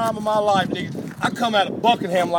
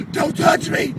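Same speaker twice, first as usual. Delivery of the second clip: higher, louder, desperate yelling; frantic yell; 9 semitones higher, 4 dB louder, slower than usual. Retold or imitated. Injured man yelling at the friend who grabs his head. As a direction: yell at him to keep his hands off you. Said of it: "he's sitting there going".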